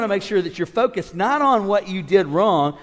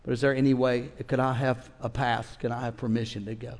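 humble apology; 6 semitones lower, 9 dB quieter than usual. Retold or imitated. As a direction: ask politely, apologetic. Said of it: "I'd have said".

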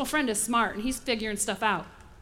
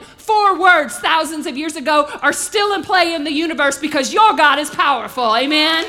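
loud, driving sermon climb; full-force preaching; 3 semitones higher, 8 dB louder than usual. Acted. Preacher to the congregation, preaching at full force.

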